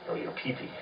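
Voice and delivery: hushed and breathy, barely voiced; near-whispered. Real, not acted. Comic telling the audience about her hand, a sly near whisper. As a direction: drop to a sly hush for the punchline.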